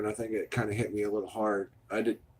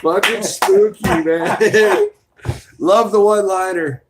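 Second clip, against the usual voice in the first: laughing; 8 semitones higher, 12 dB louder, slower than usual. Real, not acted. Laughing at chat joke.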